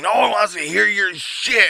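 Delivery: high, loud snarl; angry retort. Retold or imitated. Coworker shouting back during the fight, angry retort. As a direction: spit it back at him. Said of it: "He's like".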